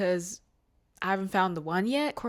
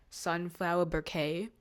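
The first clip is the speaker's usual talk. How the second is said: ordinary talk, nothing marked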